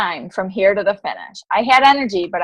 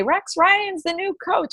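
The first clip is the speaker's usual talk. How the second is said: announcer quote; 9 semitones higher, 3 dB quieter, slower than usual. Retold or imitated. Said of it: "you can see him say".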